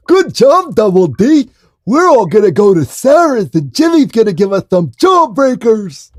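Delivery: high, loud hoarse cartoon holler; cartoon impression. Rallying Double D for jawbreakers, high loud cartoon voice. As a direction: push a big excited cartoon voice through hoarseness. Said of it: "I'm too hoarse".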